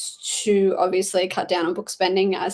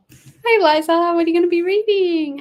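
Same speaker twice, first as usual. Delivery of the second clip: excited greeting; 8 semitones higher, 6 dB louder than usual. Real, not acted. Greeting a chat viewer.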